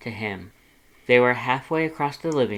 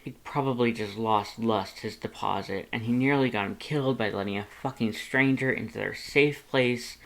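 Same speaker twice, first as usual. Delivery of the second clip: plain reading voice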